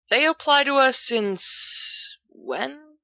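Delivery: mocking the idea of rules; drawl. A narrator's reading. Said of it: "Sirius drawls".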